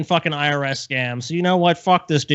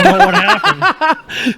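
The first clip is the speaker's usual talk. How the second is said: slow, higher and louder, breaking into laughter; laughing while talking; 9 dB louder, much slower than usual. Real, not acted. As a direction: get the words out through a real laugh. Laughing at severed hand joke.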